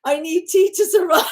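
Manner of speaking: high, whiny mock plea; hammed-up poor waif; mock pitiful. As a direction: beg for tea like a starving waif. Dramatic poor-me bit.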